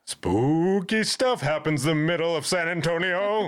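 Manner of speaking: high, slow, drawn-out ghostly tease; mock spooky voice. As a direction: ham it up like a campfire ghost tale. Jokey spooky aside on hauntings.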